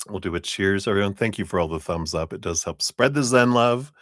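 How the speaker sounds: ordinary delivery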